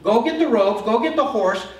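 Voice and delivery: high, loud, booming enthusiasm; a king thrilled with the idea, giving orders; delighted king. Retold or imitated. The king ordering Haman to honor Mordecai, high and loud with delight.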